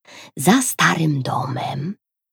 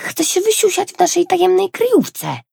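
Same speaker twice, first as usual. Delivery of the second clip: protesting. A narrator's reading. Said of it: "zaprotestował Kajtek".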